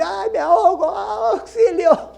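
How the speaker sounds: high, laughing mock panic; hammed-up cry for help with a laugh; mock wailing. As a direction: play the drowning cry for laughs. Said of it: "empezar a llorar y decir".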